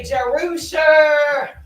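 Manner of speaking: loud, drawn-out name; long loud cry of the name; audience confession. Acted. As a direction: call it out, stretching the name. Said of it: "Somebody say".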